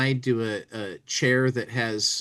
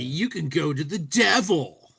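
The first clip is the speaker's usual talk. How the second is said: crude and blasphemous; 4 semitones higher than usual. Acted. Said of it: "crude and blasphemous".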